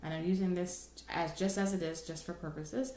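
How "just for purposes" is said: usual speaking voice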